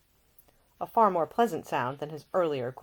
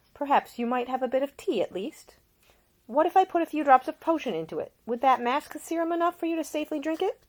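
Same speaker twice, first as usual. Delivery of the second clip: higher, brighter-pitched caring lady; a young woman tending a seasick man, solicitous and higher; Ida, gentle young woman; 10 semitones higher, 4 dB louder than usual. A narrator's reading. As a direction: voice the attentive young lady nursing him. Ida speaking.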